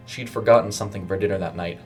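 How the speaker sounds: usual speaking voice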